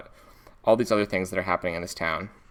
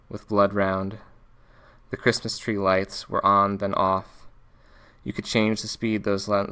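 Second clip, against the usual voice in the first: book reading. A narrator's reading. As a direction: read it steadily, with pauses between phrases. Reads the novel's opening page.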